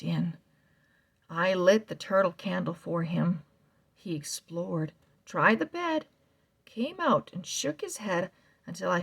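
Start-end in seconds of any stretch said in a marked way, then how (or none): none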